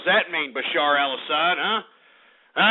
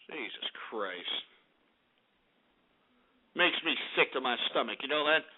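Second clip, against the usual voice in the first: sick disgusted mutter; 7 semitones lower, 9 dB quieter than usual. Real, not acted. Radio host sickened by the killings, muttering low and soft. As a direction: sink into a quiet sickened murmur. Disgusted aside after atrocity.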